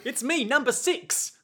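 high-pitched New Zealand comic voice; Rhys Darby. Acted. Number six popping up to Bruno, Rhys Darby impression. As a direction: chirp it up high, calling for attention. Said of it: "pretend to be Rhys Darby again".

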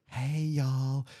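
lightly imitated friend on video; Natalie. Friend starting a video message, lightly imitated greeting. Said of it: "started with Natalie going".

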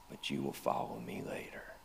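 soft whisper, almost gone; whispered promise. Acted. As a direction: breathe the promise like a secret. Jesus's promise recalled in awe.

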